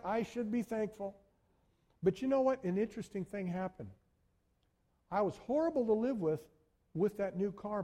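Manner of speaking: ordinary talk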